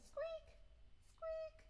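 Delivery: very high squeak; squeaky rubber duck. Pretend. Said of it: "I make noise".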